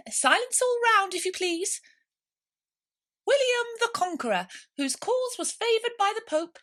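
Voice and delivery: very high, loud pompous mouse lecturing; a tiny schoolmaster reciting dry history; important mouse. A narrator's reading. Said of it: "with an important air".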